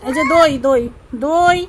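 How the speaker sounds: loud sing-song street seller call; hawker call. Pretend. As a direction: cry out your yogurt like a vendor. Playing a yogurt hawker. (দই বিক্রেতার মতো জোরে হাঁক)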